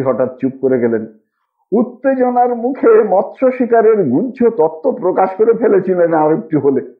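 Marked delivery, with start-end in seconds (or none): none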